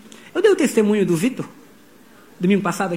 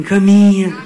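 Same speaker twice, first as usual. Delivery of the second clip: Caminha said louder, one strong falling word; firm command; 6 dB louder, slower than usual. Shown as a demonstration. Pastor has the church tell each other Caminha, loud and firm. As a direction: declare Caminha to your neighbour as an order. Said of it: "Fala pra quem tá falando assim".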